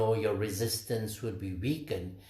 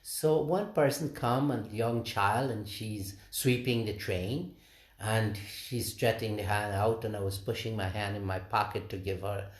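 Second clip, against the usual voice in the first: ordinary talk, nothing marked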